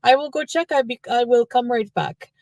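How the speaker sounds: high-pitched French Canadian volunteer; French Canadian accent. Retold or imitated. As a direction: imitate a chirpy French Canadian lady. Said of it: "'cause she's French Canadian".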